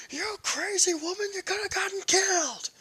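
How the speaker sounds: high, loud exasperated scolding; scolding scowl. Acted. Izuku scolding Momo for nearly dying, high and loud. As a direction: let her have it, high and loud. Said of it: "with a scowl".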